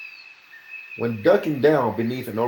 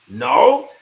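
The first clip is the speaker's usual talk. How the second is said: loud, higher scornful challenge; 8 semitones higher, 12 dB louder than usual. A narrator's reading. Bennis challenging the old man, loud. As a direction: dare him to say it again.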